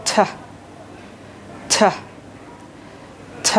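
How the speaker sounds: ঠ said alone with a strong puff; isolated aspirated ঠ, held apart; aspirated T sound. Shown as a demonstration. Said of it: "তোমরা এরকমভাবে বলতে পারো".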